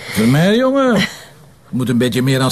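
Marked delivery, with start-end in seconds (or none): 0.1-1.0 loud, high jovial call-out; hearty call. Acted. Inspector teasing Vermeer, a loud jovial call. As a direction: boom his name out cheerfully.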